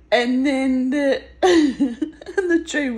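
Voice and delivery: real laughter breaking up the words; laughing. Real, not acted. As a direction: crack up mid-sentence. Cracks up while telling the dream.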